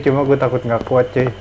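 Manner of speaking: usual speaking voice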